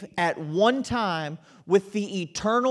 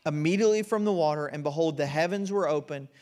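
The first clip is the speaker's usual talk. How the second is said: scripture reading; 3 semitones lower than usual. Acted. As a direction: read the verse reverently, letting it settle. Reads the baptism scripture aloud.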